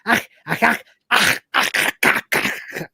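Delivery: lightly voiced Gelf clicking noises; Gelf gibberish. Crew faking Gelf language to the simulant, light creature voice. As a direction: chatter in made-up creature sounds. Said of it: "random Gelf".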